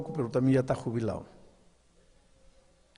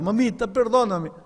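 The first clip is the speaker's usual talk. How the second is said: crying plea; 8 semitones higher, 5 dB louder than usual. Acted.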